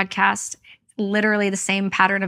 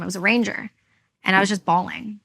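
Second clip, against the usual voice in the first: ordinary talk, nothing marked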